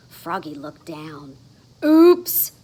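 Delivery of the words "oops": high, loud embarrassed oops. Acted. Froggy noticing his underwear, loud oops. Froggy finds he's undressed.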